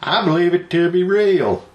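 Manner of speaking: quoting a witness. Retold or imitated. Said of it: "the videotape says".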